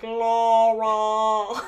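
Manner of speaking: loud, drawn-out call of "Laura" with a laugh breaking in; menacing husband. Retold or imitated. Retelling the Sleeping with the Enemy husband, loud and drawn out. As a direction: play the menacing movie husband calling for his wife. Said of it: "and he's like".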